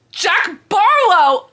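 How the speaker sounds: exasperated slow yell of a hated name; loathing shout. Real, not acted. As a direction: yell the name slowly like a curse. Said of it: "I just hate him so much".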